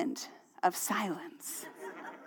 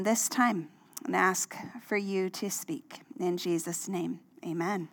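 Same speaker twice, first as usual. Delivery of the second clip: prayer; 3 semitones lower than usual. Real, not acted. Pastor prays before sermon.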